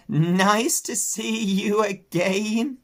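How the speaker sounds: slow, high sheep voice; a pleased ewe bleating. Acted. Sheep greeting Babe.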